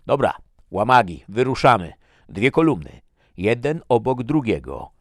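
loud, high barked orders; commander's shout. A narrator's reading. Commander Svensson ordering the expedition to form columns, shouting. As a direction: bellow at the troops to move out. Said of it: "krzyknął Svensson".

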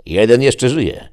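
loud call. Acted. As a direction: call it out over the field. Calling out a survivor found.

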